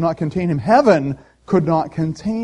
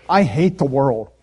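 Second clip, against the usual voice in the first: mock disgust; 4 semitones higher, 6 dB louder than usual. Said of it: "I walk away ... and I say".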